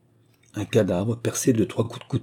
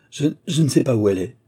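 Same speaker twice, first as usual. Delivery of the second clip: fast, stumbling, higher stammer; 5 semitones higher, 4 dB louder, faster than usual. A narrator's reading. Courtesan stammering to the agent in fear. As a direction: blurt it out in panic. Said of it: "balbutia Eudoxie".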